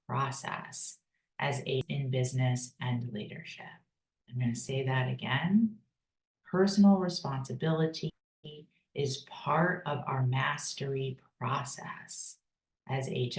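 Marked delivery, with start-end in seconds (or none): none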